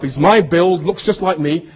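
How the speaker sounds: usual speaking voice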